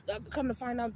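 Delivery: ordinary talk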